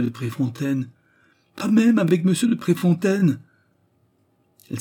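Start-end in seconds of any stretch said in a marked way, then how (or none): 1.6-3.4 very high, loud protest; indignant denial. A narrator's reading. Raymonde answering the accusation about Préfontaine, indignant cry. She hotly denies the accusation.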